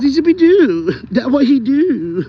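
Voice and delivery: high, loud, stretched-out doggy hype; dog catchphrase voice. Acted. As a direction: belt out the pet's catchphrase, playfully drawn out. Dog's catchphrase shouted playfully to viewers.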